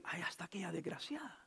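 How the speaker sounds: a hushed, unvoiced whisper of scorn; whispered insult. A church gossip whispering spite about a sister who walks in. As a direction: breathe the spite out without voice.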